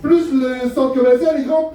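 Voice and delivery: ordinary talk, nothing marked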